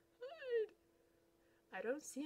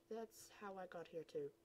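normal talking voice

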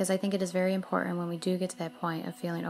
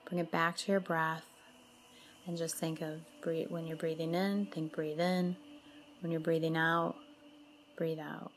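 soothing mantra-and-breath guidance, spaciously phrased; guided meditation; 7 dB quieter than usual. Acted. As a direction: lead them gently through the breathing, unhurried.

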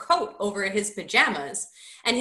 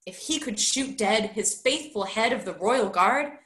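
plain reading voice

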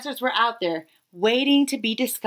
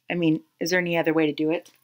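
quick aside; 5 semitones lower, much faster than usual. Acted.

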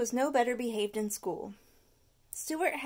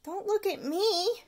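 higher, talking while chewing; chewing boy; 9 semitones higher than usual. Acted. Stuart denying it while chewing. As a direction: mumble through candy. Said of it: "chewed Stuart".

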